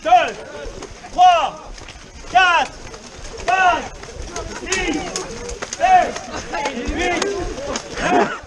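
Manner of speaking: loud counting mid jumping jacks; doing jumping jacks. Real, not acted. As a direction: shout the count while jumping. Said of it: "dix jumping jacks".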